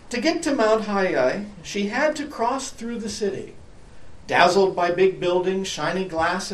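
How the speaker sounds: put-on storyteller reading to children; story narration. A narrator's reading. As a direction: read it like a picture book. Reading a picture book aloud.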